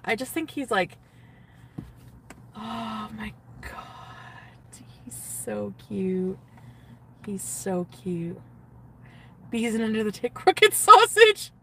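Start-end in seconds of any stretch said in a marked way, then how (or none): 2.5-4.8 slow breathless whisper; swooning whisper. Real, not acted. Gushing to the camera about her crush, slow whisper. 5.0-8.4 soft slow swoon. Real, not acted. 10.3-11.5 high and loud, laughing through it; laughing squeal. Real, not acted. Cracking herself up on camera, laughing squeal. Laughing hard at own joke.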